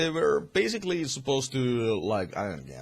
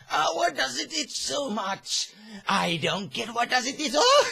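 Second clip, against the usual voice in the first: higher, odd put-on voice; a loopy, giddy mock Joker; 13 semitones higher than usual. The Joker seeing his face, lightly voiced and giddy. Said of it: "he just started laughing like".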